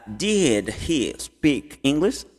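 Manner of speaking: very slow, stretched words, rising at "English"; slow modelling. Shown as a demonstration. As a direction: draw out each word for learners. Teacher models question slowly. (bem devagar, palavra por palavra)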